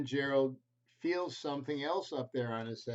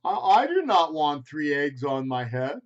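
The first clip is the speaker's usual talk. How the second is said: a baffled, put-upon elephant; Gerald the elephant; 13 dB louder than usual. Acted. Gerald voiced in picture book.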